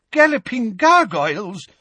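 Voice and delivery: high-pitched exclamation of shock; shocked shout. A narrator's reading. Professor forgetting the exam at the attack, shocked shout. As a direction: cry out, scandalized. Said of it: "shouted Professor Tofty".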